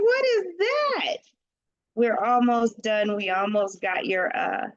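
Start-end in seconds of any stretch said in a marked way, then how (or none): 0.0-1.2 high, slow, puzzled "what is that"; baffled. Retold or imitated. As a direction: squeak it out in mock confusion. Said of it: "I'm like".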